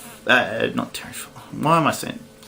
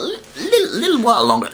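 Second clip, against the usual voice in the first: little squeaked very high through a laugh; playful squeak; 10 semitones higher than usual. Acted. Teasing that Rick Steiner keeps the belt, a high laughing squeak.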